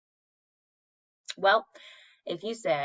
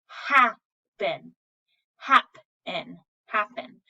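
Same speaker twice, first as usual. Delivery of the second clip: happen drawn out slowly, the short A stressed; slow demo of short A; much slower than usual. Shown as a demonstration. Teacher models the short A sound, slowly. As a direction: stretch out happen to show the short A. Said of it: "we're saying A".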